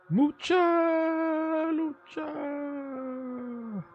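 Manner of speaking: very high, squeezed cartoon voice; Flea cartoon voice. Streamer trying out the Flea's voice, very high and squeezed. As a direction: pitch way up into a tiny cartoon wrestler. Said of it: "I don't know what voice that was".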